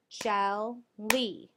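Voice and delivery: jelly broken into two loud beats, jel, lee; jelly, syllable by syllable; split into syllables. Shown as a demonstration. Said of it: "We have two syllables".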